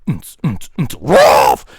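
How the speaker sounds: beatbox building to a loud woof; startlingly loud dog bark ending a beat. Acted. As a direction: thump out the beat, then burst into a woof. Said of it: "Ah, no, [name]! On fait peur".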